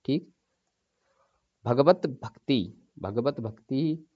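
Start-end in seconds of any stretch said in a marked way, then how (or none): 1.7-4.1 भगवत भक्ति drawn out slowly; slow, pointed. Shown as a demonstration. Teacher posing a word to students, slowly. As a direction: quiz the class with slow words. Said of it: "तो या क्या होता है".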